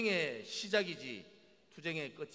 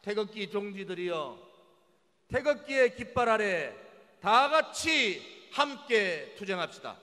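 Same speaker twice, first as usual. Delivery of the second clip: rally the flag-wavers to fight; roaring rallying speech; 7 semitones higher, 11 dB louder than usual. Acted. Rally speech at full force. (우렁찬 투쟁 호소)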